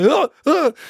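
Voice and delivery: high, panicked grunting; buddy at a loss what to do; flustered grunts. Retold or imitated. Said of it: "he's just like".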